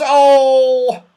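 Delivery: long, high, loud "oh"; shrieked wail. A narrator's reading. Saucepan Man realises his land is gone, shrieking. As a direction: shriek one stretched cry of horror. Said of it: "shrieked the saucepan man".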